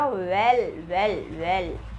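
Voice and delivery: "well" with a rounded w, repeated; rounded-lip w. Shown as a demonstration. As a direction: shape the w of "well" with round lips. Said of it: "हमारे lips round हो रहे हैं". ("well" का व होंठ गोल करके)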